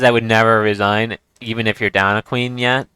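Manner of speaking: ordinary talk, nothing marked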